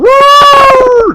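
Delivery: very high, loud, long "woo"; piercing drawn-out falsetto whoop; game character's whoop. Retold or imitated. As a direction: imitate a jumping hero's holler. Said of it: "that's actually the sound that your character makes".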